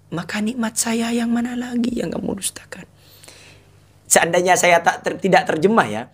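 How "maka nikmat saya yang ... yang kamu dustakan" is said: whispered translation. Shown as a demonstration. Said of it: "unvoiced whisper".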